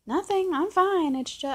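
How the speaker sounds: high, loud insistence; mock sulky girlfriend. Girlfriend telling boyfriend she's fine, mock sulky. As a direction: imitate her protesting too much.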